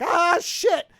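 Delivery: high, loud startled curse; mock alarmed curse. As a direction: blurt it out in sudden alarm. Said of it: "and he's like".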